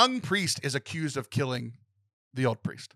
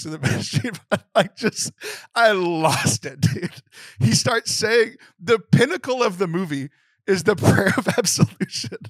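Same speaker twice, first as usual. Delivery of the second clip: high, louder voice cracking with emotion; choked up and laughing through it, pitch climbing; teary, losing it; 7 semitones higher, 5 dB louder than usual. Real, not acted. Film fan overcome retelling the final absolution, tearful high voice. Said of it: "I'm getting teary-eyed now".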